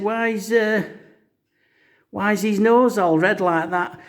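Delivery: put-on high-pitched child's question; imitated small-boy voice; little Kevin's voice. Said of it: "little Kevin looked over".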